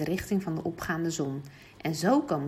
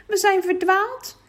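put-on high little girl crying out oh no; little girl calling out; 12 semitones higher, 7 dB louder, slower than usual. Girl lost in the woods, calling out high to her brother. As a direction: cry out like a frightened little girl. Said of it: "riep het meisje".

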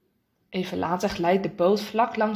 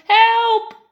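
high, loud, held scream; alarmed high shout; shouted cry for help; 12 semitones higher, 9 dB louder than usual. Acted. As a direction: yell it as you slide overboard. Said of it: "Hij schreeuwt".

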